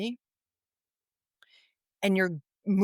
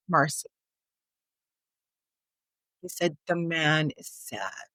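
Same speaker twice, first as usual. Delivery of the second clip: different tone. Retold or imitated. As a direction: let the weight sink into each word. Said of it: "in a totally different tone now".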